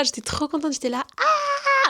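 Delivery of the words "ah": a long, very high squeal of delight; retelling her leap of joy, a drawn-out high squeal; shriek of joy. Retold or imitated. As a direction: squeal like you just got the best news. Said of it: "J'ai sauté de joie".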